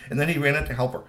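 ordinary delivery

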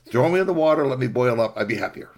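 loud plea easing down; desperate pleading. Acted. Rabbit begging to be boiled instead, mildly desperate. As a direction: start high and loud, then subside.

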